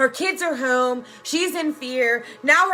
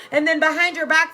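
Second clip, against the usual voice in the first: ordinary talk, nothing marked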